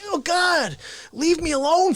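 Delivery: high, whiny mock plea; hammed-up exasperation; exasperated pleading. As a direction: beg to be left alone, pitched high. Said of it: "And I'll be like".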